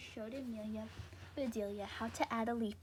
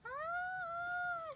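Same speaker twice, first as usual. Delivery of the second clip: very high frightened shriek; high startled scream. Acted. Mister Rogers scared by the scarecrow, high shriek. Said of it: "screamed Mister Rogers".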